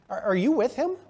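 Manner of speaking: lightly acted servant girl's query; servant girl questioning. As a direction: stumble into the question, pitch climbing. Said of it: "servant girl goes up to Peter and says".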